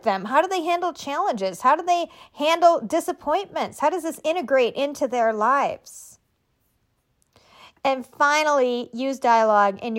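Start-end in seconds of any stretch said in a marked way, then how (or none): none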